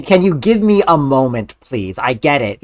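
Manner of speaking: usual speaking voice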